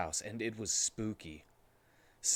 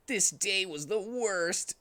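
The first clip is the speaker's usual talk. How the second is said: high, slow, sorry for himself; moping Sonic; 8 semitones higher, slower than usual. Acted. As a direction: drag out the misery. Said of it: "said Sonic".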